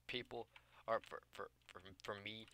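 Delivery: stuttering on "for, for"; repeating "for" while stuck. Real, not acted. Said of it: "oh, stutter".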